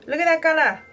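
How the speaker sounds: sudden gasp, then high-pitched surprise; gasp, then point at the hair in disbelief; surprised gasp. Real, not acted. Spots a gray hair, gasps.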